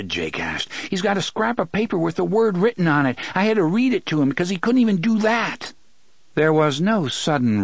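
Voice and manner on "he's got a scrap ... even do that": high, quick protest; high, hurried self-defence; Gasher's agitated voice. A narrator's reading. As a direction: blurt out the accusation, high and fast. Gasher keeps defending himself.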